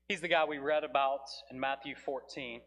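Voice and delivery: ordinary talk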